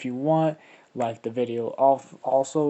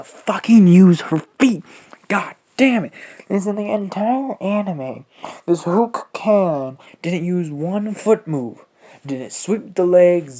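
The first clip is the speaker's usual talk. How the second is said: heated rant; 6 semitones higher, 11 dB louder than usual. Real, not acted. Angry rant about the anime.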